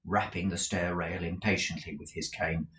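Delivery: ordinary delivery, nothing marked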